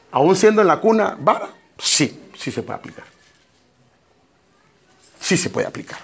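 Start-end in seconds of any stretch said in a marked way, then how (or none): 1.8-6.0 low, soft, half-whispered yes; quiet, careful confirmation; hushed admission. Real, not acted. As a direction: drop almost to a whisper for the answer. Hushed answer to delicate question.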